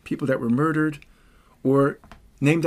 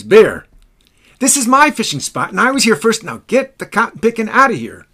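booming, much louder and higher; stentorian voice; 7 semitones higher, 8 dB louder than usual. Retold or imitated. General facing down the bear: booming stentorian shout. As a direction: stand your ground and roar it at him. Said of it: "in a very stentorian voice".

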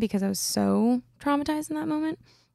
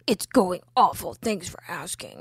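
sullen retort; 2 semitones lower than usual. Retold or imitated. Boyfriend snubbing the Uber driver's small talk, sullen retort. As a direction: imitate a sulky, drunk boyfriend.

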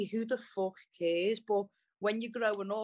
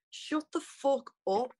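retold exasperated retort; 6 semitones higher, slower than usual. Mum cuts off the apology.

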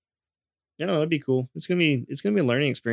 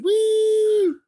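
gleeful high whoop, much louder; excited whoop; 4 dB louder than usual. Retold or imitated. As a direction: whoop like a kid on a ride. Kid on backyard ride.

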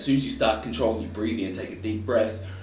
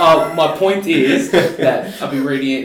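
louder and higher through laughter, slowed down; laughing; 7 semitones higher, 12 dB louder, slower than usual. Real, not acted. Getting back to the point after a joke, laughing. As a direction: push the point out over shared laughter.

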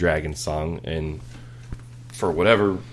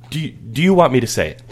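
put-on eager kid voice; 6 semitones higher, 5 dB louder, faster than usual. Son asks again, eager.

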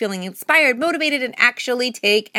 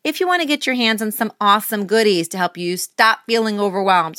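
ordinary delivery

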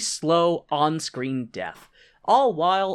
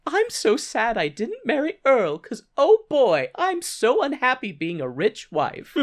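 high-pitched fake lament; hammed-up phony sorrow; sarcastic mock regret; 6 semitones higher than usual. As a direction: pretend to be heartbroken, mockingly.

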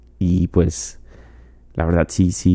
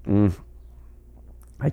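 usual speaking voice

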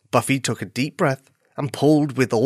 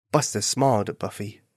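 ordinary delivery

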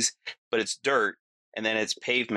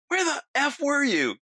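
much higher, mock-indignant outburst; retold outraged friend. Friend bursting out at the driver who left him, mock outrage. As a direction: imitate a friend's high-pitched outrage, without shouting. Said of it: "Eric comes flying out like".

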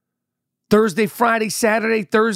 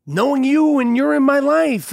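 higher, gushing mock toast; lightly voiced adoring speech, pitched up; maid of honor; 6 semitones higher than usual. Maid of honor praising the bride, gushing. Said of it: "then her maid of honor".

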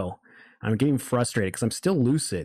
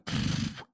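breathy whoosh of fast movement; imitated rushing whoosh; 6 dB quieter than usual. Dreamer mimics the snake moving through walls, imitated whoosh. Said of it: "It's like".

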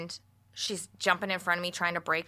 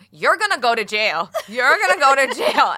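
high, loud imitated Filipino accent, laughing; 7 semitones higher, 11 dB louder than usual. Father-in-law warns her in Filipino accent. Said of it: "he goes".

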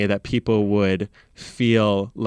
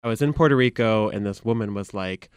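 ordinary talk

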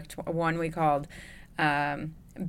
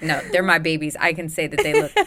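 defiant retort; 7 dB louder than usual. Retold or imitated. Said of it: "I'm like".

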